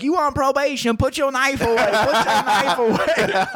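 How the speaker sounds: high-pitched mock pleading; hammed-up high warning, ending in laughter; panicked table companions. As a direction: imitate alarmed friends in a squeaky-high voice. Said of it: "And the whole table's like".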